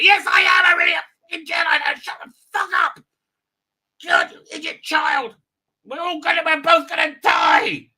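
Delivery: high, loud exasperated tirade; over-the-top angry yelling; furious shouting. Acted. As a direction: scream it in full-blown mock fury. Acted outburst at doubting question.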